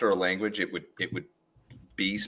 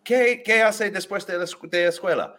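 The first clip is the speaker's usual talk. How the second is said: high-pitched, lively teacher asking in Spanish; imitated teacher's quick, high question; 10 semitones higher than usual. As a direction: ask brightly like a classroom teacher, pitched up. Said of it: "the teacher would ask her".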